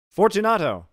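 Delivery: loud, high call into the dark; calling aloud. Acted. Montresor calling Fortunato through the wall. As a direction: shout his name into the niche. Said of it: "I called again".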